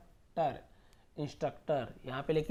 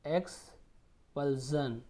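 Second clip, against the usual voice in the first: slow syllables; much slower than usual. Shown as a demonstration. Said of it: "जन जन उच्चारण".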